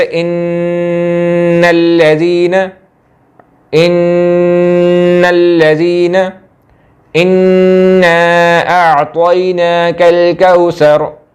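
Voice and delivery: very slow chanted tilawat, the doubled noon of इन्नल्लज़ीना and इन्ना held long through the nose; slow melodic recitation with a long nasal noon; Quranic recitation with ghunna. Shown as a demonstration. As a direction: draw out the nasal ghunna like a qari. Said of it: "गुन्ना इस तरह करते हैं कि".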